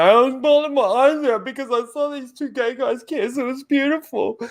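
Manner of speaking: imitating a gushing sentimental director; mock weepy gush. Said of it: "come out and say".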